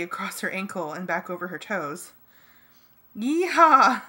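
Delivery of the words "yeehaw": loud, high cowboy whoop; triumphant crow. A narrator's reading. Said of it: "I crowed to Ax".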